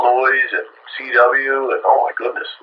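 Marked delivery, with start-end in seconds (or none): none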